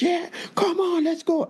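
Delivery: high, bright-eyed hype cheer from the dancers. Retold or imitated. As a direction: pump up the room like an American dancer cheering you on. Imitating American dancers cheering.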